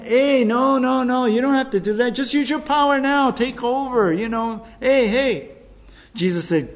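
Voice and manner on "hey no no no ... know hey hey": higher, louder, pushy tempter; tempter's wheedling voice. Acted. Satan urges Jesus to seize power, high and pushy. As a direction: needle him like an eager tempter. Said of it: "Coming in".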